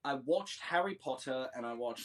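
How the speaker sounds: put-on British accent in conversation; British accent, storytelling. Shown as a demonstration. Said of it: "The way that I got this accent".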